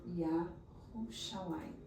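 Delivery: "Yahushalem" spelled out slowly, falling; slow correct Yahushalem. Shown as a demonstration. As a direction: teach "Yahushalem" syllable by syllable. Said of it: "o correto é Yahushalem". ("Yahushalem" devagar, sílaba por sílaba)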